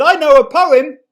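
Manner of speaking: high, loud desperate shout; boy shouting to stop the girl walking away, loud. A narrator's reading. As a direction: call after her before she leaves. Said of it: "So he shouted".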